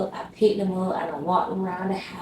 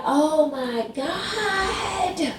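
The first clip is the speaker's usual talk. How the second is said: much higher, very slow, stretched groan of words; drawn-out despair; 9 semitones higher, much slower than usual. Retold or imitated. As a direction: at the end of her rope. Baby howling again at 3am.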